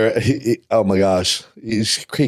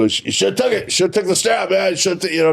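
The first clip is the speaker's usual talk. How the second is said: a touch of Flair's voice, pitched higher; imitating Ric Flair lightly; 8 semitones higher than usual. Wrestler retelling Flair's teasing about the belt, lightly imitated. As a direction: lightly mimic the old champion's needling. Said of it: "he reminded me of that".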